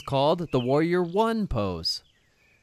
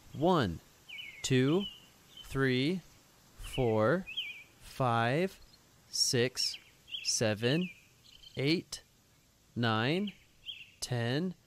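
put-on teaching a child to count; 2 semitones lower, 4 dB quieter, much slower than usual. Counting aloud for kids' yoga.

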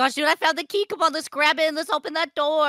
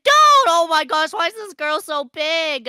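loud, high outburst; alarmed surprise; 5 semitones higher, 7 dB louder than usual. Real, not acted. Player confronted by a giant girl, alarmed. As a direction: yell it in alarm. Sees a huge enemy.

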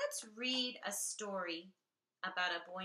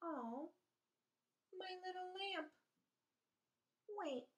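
tender; 12 semitones higher, 7 dB quieter than usual. Acted. Tender over broken lamp.

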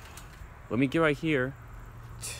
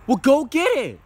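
louder, higher, bossy command; yelling at a friend to go fetch the ball; loud order; 10 semitones higher, 10 dB louder than usual. Acted. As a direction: bark it at him.

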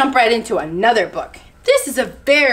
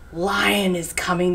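ordinary delivery, nothing marked

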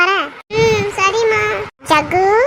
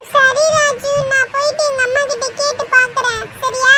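put-on high little-kid voice; high, childish and obliging; agreeable child friend; 5 semitones higher than usual. Child Pappu agrees to ask.

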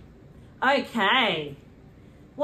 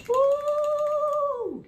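excited cheer. Acted. Cheer at present.

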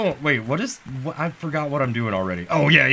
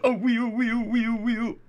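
mock wailing baby; 8 semitones higher than usual. Baby mourning his ketchup-covered papa, hammed-up wail. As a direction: wail like a toddler in mock grief.

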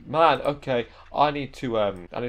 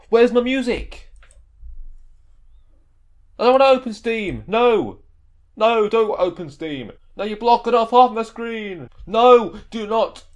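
exasperated yelling; 9 semitones higher, 6 dB louder than usual. Real, not acted. Panicking at popup on screen.

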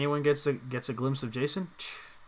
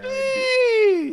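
shrill mock wail; high squeal; 10 dB louder than usual.

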